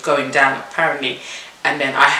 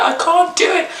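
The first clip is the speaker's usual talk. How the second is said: retold scream; 13 semitones higher than usual. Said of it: "I'm screaming".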